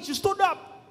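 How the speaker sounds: ordinary talk, nothing marked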